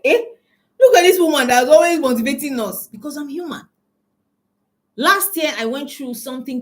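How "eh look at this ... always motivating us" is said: mock astonished gasp, louder; playing the stunned audience; shocked audience. Mocking her audience's shocked disbelief.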